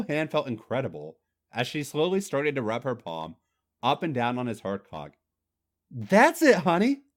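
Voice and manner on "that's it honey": higher, louder encouraging coax. A narrator's reading. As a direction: urge him on kindly. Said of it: "Trish said encouragingly".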